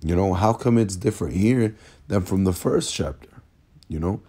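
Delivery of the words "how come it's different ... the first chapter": ordinary delivery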